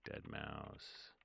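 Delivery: ordinary delivery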